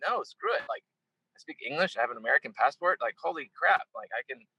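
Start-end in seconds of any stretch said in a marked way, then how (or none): 0.1-0.6 a higher, brushing-off screw it; defiant dismissal. Retold or imitated. Rejecting guilt about privilege, defiant dismissal. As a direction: wave the guilt away. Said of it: "I'm like".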